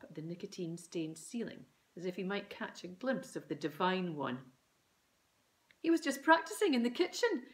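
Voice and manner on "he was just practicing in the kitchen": fast, high, loud mum jabber; excited jabber. A narrator's reading. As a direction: gabble it out excitedly. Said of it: "jabbered Mum excitedly".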